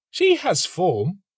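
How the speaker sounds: student musing. A narrator's reading. Student judging the nightingale to himself, slow and high. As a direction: weigh her up coolly.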